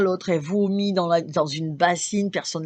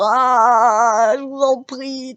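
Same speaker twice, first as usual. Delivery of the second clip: slow, louder drawn-out plea; pleading sick man; 6 semitones higher, 7 dB louder, much slower than usual. Retold or imitated. The man on the stretcher begs her, slow loud plea. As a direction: beg her weakly for help. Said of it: "il me refait le geste".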